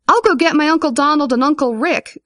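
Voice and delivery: put-on high, excited little-girl voice; eager cry. A narrator's reading. Girl offering her uncles to help, eager high cry. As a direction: pipe up eagerly. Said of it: "cried one of the girls".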